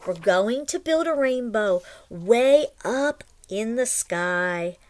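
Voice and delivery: lively storybook read-aloud; reading to children. A narrator's reading. Teacher reads the story aloud to the class, lively storybook voice. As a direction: read it like a picture book to little ones.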